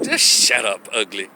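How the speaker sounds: low, contemptuous brush-off; dismissive put-down. Real, not acted. Telling feminists to shut up, low and contemptuous. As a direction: sneer it down low.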